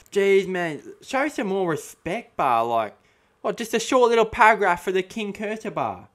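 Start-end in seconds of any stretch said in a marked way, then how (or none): 3.4-5.7 loud, higher indignant complaint; mock outrage. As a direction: act insulted on his behalf. Outraged at short paragraph.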